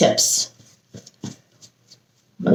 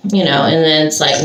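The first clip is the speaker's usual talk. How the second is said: normal talking voice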